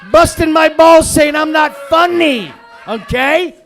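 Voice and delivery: furious screaming rant. Real, not acted. Comic screams at the commenter who says he's not funny, furious shout. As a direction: scream every word at him.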